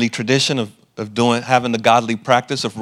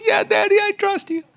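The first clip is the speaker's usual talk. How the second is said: put-on much higher, small child voice saying yes to daddy; little girl voice. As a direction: play his young daughter, pitched way up. Father voices young daughter.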